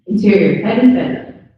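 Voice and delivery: loud, announcing the scene heading; script heading read aloud. A narrator's reading. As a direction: call out the slug line to the room. Reads scene heading loudly.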